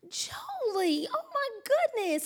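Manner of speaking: high, soft, appalled cooing at a pet; mock dismay. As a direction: gasp it high and softly at the dog. Retold reaction to dog spitting up.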